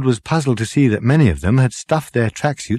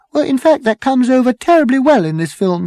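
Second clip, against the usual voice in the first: put-on high, loud, strident girl's voice; 4 dB louder than usual. A narrator's reading. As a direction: cut in stridently, pitched right up. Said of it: "the girl with the strident voice".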